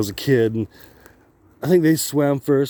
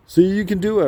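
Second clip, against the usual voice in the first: higher, mocking praise; proud dad; 7 semitones higher, 3 dB louder than usual. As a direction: play the dad who scared you into it. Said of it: "my dad's like".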